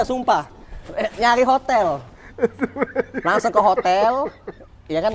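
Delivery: ordinary talk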